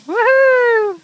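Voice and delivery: loud, high woohoo; excited whoop. Real, not acted. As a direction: whoop with delight. Cheering a sideways snowboard run.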